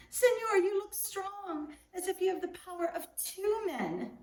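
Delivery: butter the gentleman up so he takes over; flattering plea. Acted. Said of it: "Juan continued".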